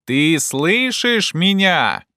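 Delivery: slow, high, carrying question; shouting up. A narrator's reading. Legrand checks Jupiter hears him, calling up. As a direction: call out to check you are heard. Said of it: "крикнул Легран".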